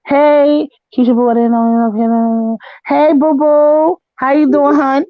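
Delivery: high, stretched-out sweet hello; sweet greeting. Real, not acted. Greeting Keisha Boo in the chat, sweetly. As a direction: coo it at a friend in chat.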